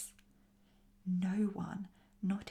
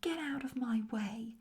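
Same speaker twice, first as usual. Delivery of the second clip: higher, mild narrated shout; 5 semitones higher than usual. A narrator's reading. Goblin shoves the other aside, shouting mildly. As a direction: shout it crossly, without full force. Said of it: "one of them shouted".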